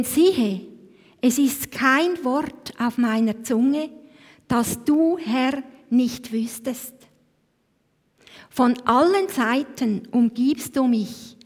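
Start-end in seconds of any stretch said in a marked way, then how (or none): none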